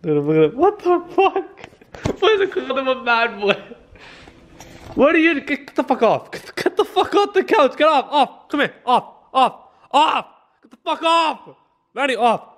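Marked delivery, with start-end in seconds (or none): 0.6-3.6 high, comic voice for a dog; puzzled dog's voice. Pretend. 5.0-12.6 high, urgent orders to a dog; scolding. Real, not acted.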